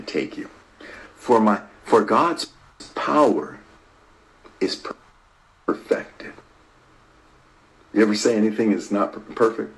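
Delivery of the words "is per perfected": soft preacher's whisper; hushed whisper. Real, not acted. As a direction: whisper the word, almost no voice. Preacher drops to hushed emphasis.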